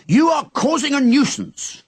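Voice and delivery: high, loud official scolding; policeman bellowing. Acted. Policeman lecturing Henry at the door, bellowing. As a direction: bellow the charge at him. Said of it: "the policeman bellowed".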